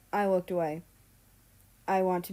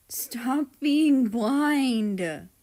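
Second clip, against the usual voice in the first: dramatic title; 5 semitones higher, 7 dB louder, much slower than usual. A narrator's reading. Chapter title read dramatically.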